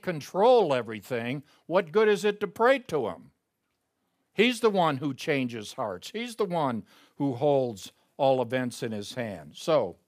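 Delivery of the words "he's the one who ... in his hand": ordinary delivery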